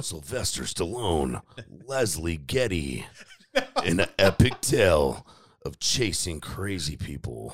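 slow, loud epic trailer narration; movie trailer voice. Pretend. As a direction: announce the cast slowly, with drama. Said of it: "can you do the movie voice".

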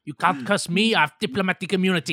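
loud, fast jokey declaration; loud mock accent line. Joking in the king's voice, loud and fast. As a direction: fire it off smugly. Joke voicing a South African.

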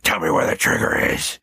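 low throaty growl; growled demand, nose blocked off; low, rasping masked-hero impression. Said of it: "like a throaty growl".

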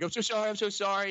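lightly imitated tearful apology; hysterical crying. Caught kid apologizing to the cop, mock hysterical. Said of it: "start hysterically crying and freaking out".

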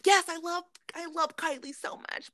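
high-pitched parody of adoration; mock gushing fans. Mocking Kylie's fans who forgave her, high mock gushing. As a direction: gush in a high put-on fan voice. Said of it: "y'all will say y'all cancel somebody".

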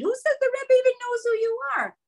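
high sneering inner doubter; mocking inner voice. A mother voices the taunting doubt in her head, high and sneering.

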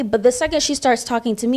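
ordinary talk

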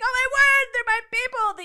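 high, loud, delighted outcry; thrilled exclaim. Retold or imitated. To new friends, a high excited squeal. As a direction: squeal it like finding your tribe. Meeting fellow I types.

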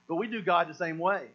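ordinary delivery

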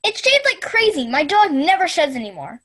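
high, loud thrilled customer; excited testimonial. Acted. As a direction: rave like a happy buyer. Testimonial in mock ad.

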